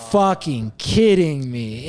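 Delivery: slow, emphatic retold incredulity; exasperated disbelief. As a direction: space out the words, utterly fed up. Retold disbelief at number mix-up.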